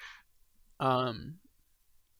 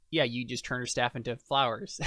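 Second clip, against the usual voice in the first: ordinary delivery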